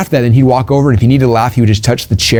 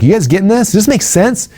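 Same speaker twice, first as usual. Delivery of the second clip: much higher, pumped-up speaker voice; hyped stage questions; 9 semitones higher than usual. Shown as a demonstration. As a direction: fire up the crowd from stage. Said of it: "I'll ask a question like".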